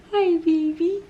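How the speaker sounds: high, sing-song greeting. Real, not acted. Cooing to baby.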